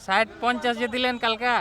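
calling out. Real, not acted. Bargaining loudly with vendor.

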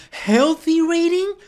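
high, slow, disbelieving repeat; can't believe what you heard; slow, shocked double-take; incredulous. Real, not acted. Mocking her health rating.

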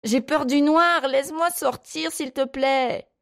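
put-on very high pleading child; high-pitched, weepy begging; tearful, frightened. A narrator's reading. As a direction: plead as a crying boy scared of the dark. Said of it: "Marc se mit à pleurer".

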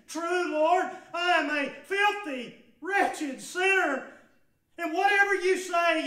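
loud, high, impassioned preaching; preaching at full force. Acted. Preacher pressing the woman's confession on the congregation, impassioned. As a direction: drive each word home to the congregation.

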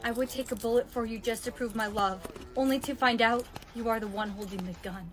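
low, soft recitation; hushed, low lyric answer; grim realisation. Acted. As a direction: recite it quietly and gravely.

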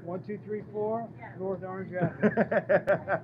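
slow address, laughing a little; slow, clear with a laugh. Shown as a demonstration. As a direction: spell out the address slowly, chuckling. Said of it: "Is that the address, just to make sure".

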